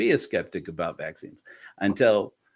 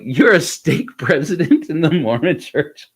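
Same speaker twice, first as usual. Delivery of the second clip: louder, laughing through it; laughing disbelief; 6 semitones higher, 6 dB louder than usual. Retold or imitated. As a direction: laugh in astonishment. Disbelief at young stake president.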